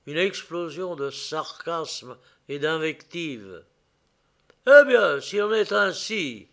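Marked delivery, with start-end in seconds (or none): 4.7-6.5 bellowing gang leader; angry boss. A narrator's reading. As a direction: roar it out, furious. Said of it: "hurla le chef de la bande".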